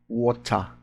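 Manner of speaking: British "water" with the t fully sounded; British-accented "water", like WOH-tah, softly. Shown as a demonstration. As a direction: say "water" the British way, crisp t, "ah" ending. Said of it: "Já o T do inglês britânico".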